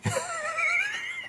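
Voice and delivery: a sudden high-pitched squeal of laughter. Real, not acted. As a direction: burst into a squeaky giggle. Laughing at the old angle.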